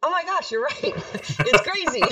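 very high laughing exclamation; high, giggly self-quote; laughing amazement. Retold or imitated. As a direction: replay an amazed reply, breaking into laughter. Acts own amazed reply, laughing.

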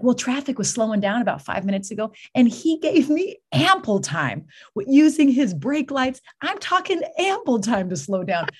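milk the tiny virtue for laughs; mock-earnest report.

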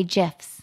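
"gifs" pronounced "jifs"; soft g. Shown as a demonstration. Host citing the looked-up way, "gifs" with soft g. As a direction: start "gifs" with a j sound. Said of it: "No, it's gifs".

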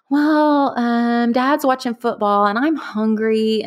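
put-on high child's voice, innocent; little boy voice. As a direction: sound like a small hungry boy. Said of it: "And he said".

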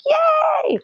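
hammed-up squeal of joy; yay. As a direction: cheer like a thrilled girl. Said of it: "And so I'm like".

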